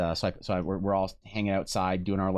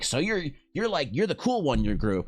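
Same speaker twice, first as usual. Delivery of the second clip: curious dad; 8 semitones higher than usual. Retold or imitated. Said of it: "And he was like".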